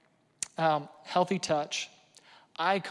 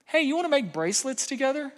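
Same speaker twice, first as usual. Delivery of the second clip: put-on higher, bright offer to a teen; cheerful invitation; 7 semitones higher, faster than usual. Dad inviting daughter to make bracelets, upbeat higher offer. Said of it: "I said".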